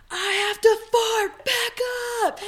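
high, slow urgent yell; retold yell. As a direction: yell the warning, drawn out and high.

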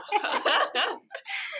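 high, bursting giggle. Real, not acted. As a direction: laugh it off at her friend's habit. Laughing at her own catchphrase.

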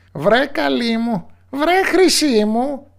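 slow, high, loud pleading witch cry; drawn-out witch call. Acted. Witch pleads slowly and loudly for her missing daughter. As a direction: stretch the call out, worried now. Witch calls, growing worried.

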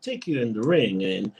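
low, halting Shatner-style delivery; lightly imitating Keith Lee as Captain Kirk; Shatner impression. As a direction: pause dramatically like Shatner doing a wrestling promo. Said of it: "he talked like William Shatner".

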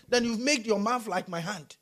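ordinary delivery

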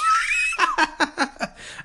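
high-pitched giggling fit; helpless laughter. Real, not acted. Finally finding the word starstruck, high laughter. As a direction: squeal with laughter at your own slip. Cracks up at his slip.

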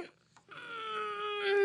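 soft, high sleepy hum; waking-up moan. Acted. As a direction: hum drowsily as if just waking. Rabbit waking up in the story.

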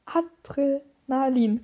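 Adrenalin drawn out slowly, piece by piece; slow, careful Adrenalin; very slow pronunciation. Shown as a demonstration. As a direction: sound out Adrenalin carefully, with gaps between parts. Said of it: "Dann mach's ganz langsam".

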